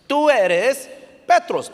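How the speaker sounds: TÚ ERES high and loud, drawn out; slow emphatic quote. Retold or imitated. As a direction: proclaim "Petros" slowly after a held "eres". Preacher quoting Jesus with weight.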